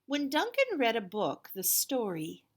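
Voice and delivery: put-on picture book read to children; storybook narration. A narrator's reading. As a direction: tell it to the kids with big energy. Reads the picture book aloud.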